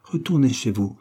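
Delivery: plain reading voice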